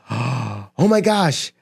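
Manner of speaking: slow, breathless "Oh my gosh"; mock panic. Panicking investor reading the news, slow stunned dread.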